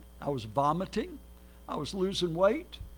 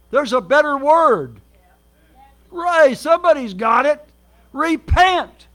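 much louder, higher, slow call to the crowd; preaching shout; 5 semitones higher, 10 dB louder, slower than usual. Acted. Preacher calling the congregation to shout the answer, loud preaching. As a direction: drive it home from the pulpit.

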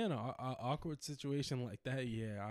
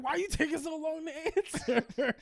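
much higher, slow, laughing put-on coworker voice; impatient coworker; 10 dB louder than usual. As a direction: needle him like a nosy coworker. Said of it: "she was like".

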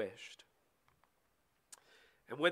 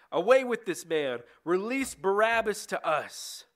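raised, high shout of a crowd; 5 semitones higher, 5 dB louder than usual. A narrator's reading. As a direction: call out the demand loudly. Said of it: "the whole crowd shouted".

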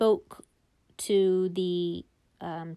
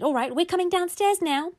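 lightly voiced higher kid voice; children's reply; 9 semitones higher than usual. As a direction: answer mum like obliging kids. Children answering mum in a story.

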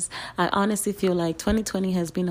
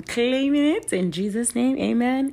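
fervent amen; 5 semitones higher, 5 dB louder than usual. Real, not acted. Prayer claim for new year.

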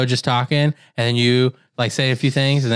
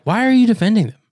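incredulous; 8 semitones higher, 3 dB louder, faster than usual. Retold or imitated. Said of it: "I'm like".

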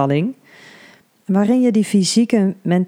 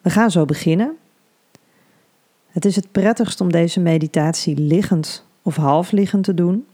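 ordinary talk, nothing marked

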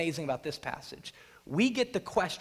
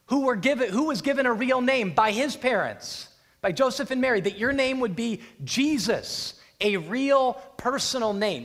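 emphatic paraphrase; 6 semitones higher, 4 dB louder than usual. Acted. Preacher paraphrasing the demon.

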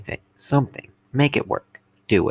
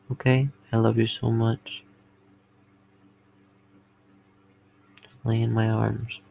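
sweet nothings. Acted. Sweet talk to partner.

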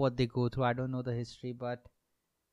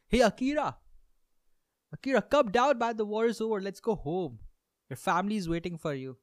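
calling out; 8 semitones higher than usual. Pretend. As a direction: shout up to the hiding soldier. Calling a soldier from the forest.

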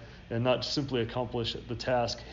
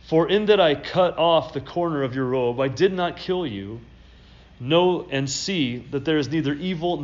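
louder, raised Bible reading; scripture reading; 3 semitones higher, 4 dB louder than usual. Acted. Pastor reads David's plea to Saul from scripture, declaiming. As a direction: read it out with conviction.

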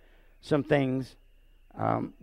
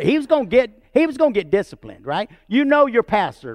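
animated; 6 semitones higher, 6 dB louder than usual. Real, not acted. Pastor preaches animatedly.

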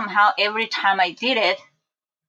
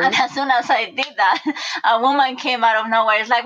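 ordinary talk

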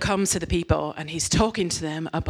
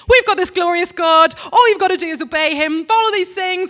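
much higher and louder plea; exasperated pleading; 12 semitones higher, 8 dB louder than usual. Retold or imitated. Preacher pleading with the Israelites, louder exasperated plea. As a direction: plead with them, nearly shouting.

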